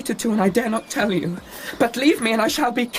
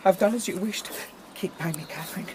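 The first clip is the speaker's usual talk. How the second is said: much softer, frightened pleading; cowed and faint; 7 dB quieter than usual. Acted. Linton to his father, fearful and much softer. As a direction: shrink away from the father.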